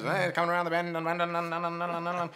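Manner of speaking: a hint of a breathless track announcer; mock race caller. Actor imitates old radio race callers, mock commentary. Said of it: "the horse race, you know, commentators".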